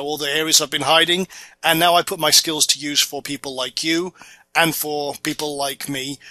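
normal talking voice

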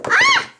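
sudden very high yelp; short startled shriek. Pretend. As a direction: let out a quick high shriek. Toy doll startled.

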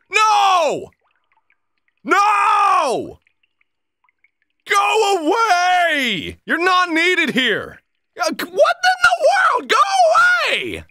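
high, frantic yelling; panicked shouting. Real, not acted. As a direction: shriek in panic.

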